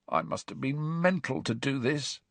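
croaky, hushed and quiet; creaky whisper. A narrator's reading. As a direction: rasp it out under your breath. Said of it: "he said in a croaky whisper".

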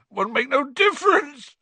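high, loud acted sob; sobbing. A narrator's reading. Gamekeeper answers his friends' comfort, sobbing in despair. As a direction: wail it out in despair. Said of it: "sobbed Hagrid".

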